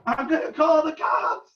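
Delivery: mild mock shout; retold threat. Friend's father to the honking fan, calling out a threat. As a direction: call out a fed-up warning. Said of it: "called out".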